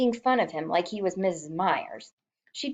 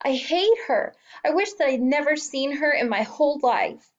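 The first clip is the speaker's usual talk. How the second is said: sobbing; 7 semitones higher than usual. A narrator's reading. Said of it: "through his sobs".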